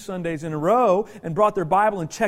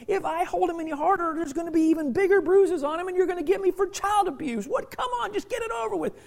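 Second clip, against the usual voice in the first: exasperated protest; 8 semitones higher, faster than usual. Retold or imitated. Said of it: "I'm like".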